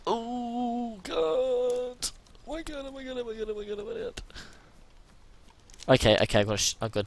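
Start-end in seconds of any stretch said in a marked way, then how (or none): 0.1-4.2 high, breathy panicked squealing; terrified high-pitched squeals at zombies. Real, not acted. Panicked squeals during zombie attack.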